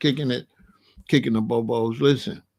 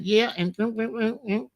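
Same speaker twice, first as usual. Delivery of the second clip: higher, hushed mimicked chatter; mama's church talk; 5 semitones higher, 3 dB quieter than usual. Son mimicking mama talking after church, mimicked polite murmur. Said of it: "I seen my mama talking".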